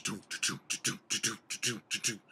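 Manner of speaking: rhythmic "doom-doom" charge noise; rapid percussive hoof imitation; galloping hoofbeats. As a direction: pound out a running beat. Imitates charging buffalo's hooves.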